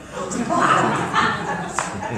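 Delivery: bursts into high breathy laughter; real laugh. Real, not acted. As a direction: laugh at the memory. Laughing at own story.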